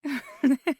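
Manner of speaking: bright burst of giggling; amused laugh. Acted. Amused by the partner's reply, bursting laugh. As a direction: laugh out loud, delighted.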